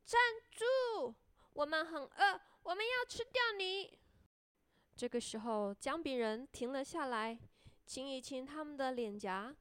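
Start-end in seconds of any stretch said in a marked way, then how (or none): 0.1-4.0 put-on high-pitched children's cry; children shouting. A narrator's reading. Children chasing their runaway cookie, acted high shouting. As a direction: call out high, like hungry storybook kids. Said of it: "孩子们大声地喊".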